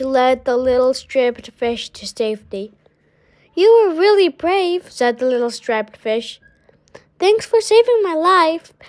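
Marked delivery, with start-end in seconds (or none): 3.5-4.9 higher, admiring thanks; grateful. A narrator's reading. Striped fish thanking Rainbow Fish after the rescue, grateful. 7.2-8.7 higher, heartfelt thanks; grateful. A narrator's reading. Striped fish thanking his rescuer, heartfelt.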